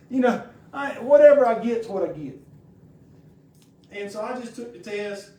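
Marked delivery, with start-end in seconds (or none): 0.1-2.4 raised, defiant declaration to himself; loud retort. Retold or imitated. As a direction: announce it loudly, shrugging it off. Retold defiant self-talk.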